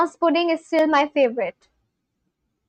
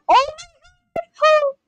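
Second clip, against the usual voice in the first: overwhelmed squeal; 13 semitones higher than usual. Real, not acted. Streamer about to cry over a dessert picture, overwhelmed squeal. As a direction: squeal it, overcome by what you see.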